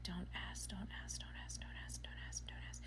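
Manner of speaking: rapid repeated whisper under the breath; whispered plea. Retold or imitated. Customer silently begging herself not to ask the clerk, whispered plea.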